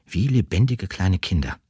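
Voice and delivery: usual speaking voice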